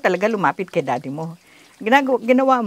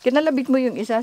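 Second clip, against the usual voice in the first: ordinary talk, nothing marked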